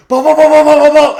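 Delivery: high, loud excited dog chant; Rottweiler energy. Acted. Dog chasing a thrown ball, frantic loud excitement. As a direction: bark the word over and over like a big dog. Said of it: "I'm giving him Rottweiler energy".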